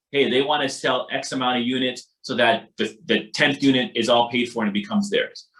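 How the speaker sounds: usual speaking voice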